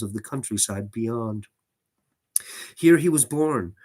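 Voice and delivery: measured, unhurried storytelling read; story narration. A narrator's reading. Reading a horror story to the stream, narrating. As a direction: read it like a gothic tale to listeners. Reads Lovecraft story aloud.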